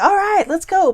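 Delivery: high, loud and keen; eager retold reply. Retelling a dream to viewers, keen and upbeat. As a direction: jump at the invite, all in. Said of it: "I'm like".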